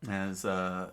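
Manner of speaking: ordinary delivery, nothing marked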